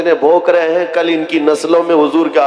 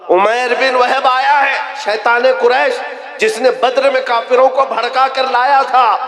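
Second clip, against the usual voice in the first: alarmed warning; 7 semitones higher than usual. Acted. Umar warns the Prophet.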